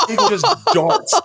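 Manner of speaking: high-pitched, slow, through real laughter; laughing. Real, not acted. Storyteller telling how the heckler ran off, laughing. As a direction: crack up while telling the punchline. Cracking up at his own punchline.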